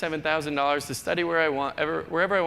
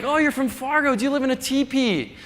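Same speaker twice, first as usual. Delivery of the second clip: higher, louder mock stranger; mocking a clueless stranger; 8 semitones higher, 4 dB louder than usual. A stranger quizzes the Fargo native, higher mock voice. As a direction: ham up the ignorant question. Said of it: "or they're like".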